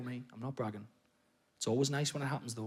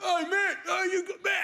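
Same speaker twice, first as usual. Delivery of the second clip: put-on drunk heckler; 11 semitones higher than usual. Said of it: "And he was like".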